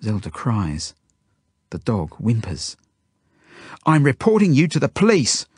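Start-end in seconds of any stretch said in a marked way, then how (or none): 3.9-5.5 high, loud threat; indignant yell. A narrator's reading. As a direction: yell it at the captor. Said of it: "I yell at the woman".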